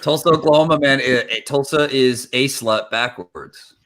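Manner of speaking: ordinary talk, nothing marked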